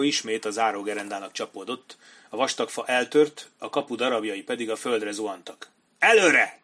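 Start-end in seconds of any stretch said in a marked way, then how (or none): 6.0-6.6 loud, high battle cry to advance; commanding shout. A narrator's reading. Kenny orders his men to charge the broken gate, shouting. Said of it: "kiáltotta Kenny".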